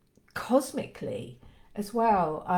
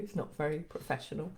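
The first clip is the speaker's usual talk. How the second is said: ordinary talk, nothing marked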